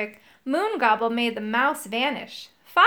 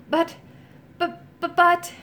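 high, stuck stammer; can't get the words out; 6 semitones higher than usual. A narrator's reading. Moongobble stammers, trying to talk.

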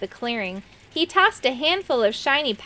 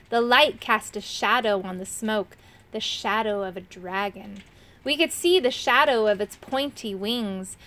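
ordinary delivery, nothing marked

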